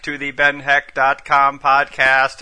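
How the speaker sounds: slow, flat robot voice. Pretend. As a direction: speak like a droning machine. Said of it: "try to sound like a robot".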